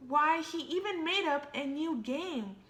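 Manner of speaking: usual speaking voice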